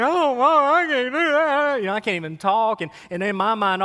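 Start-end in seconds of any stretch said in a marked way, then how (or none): 0.0-1.8 put-on higher, louder, wailing little boy; half-cry it like a four-year-old; child breaking down. Boy begging his mom not to make him confess. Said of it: "the dam breaks".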